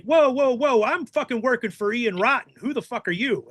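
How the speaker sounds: a hammed-up offended wrestler; mock indignant. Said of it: "And he's like".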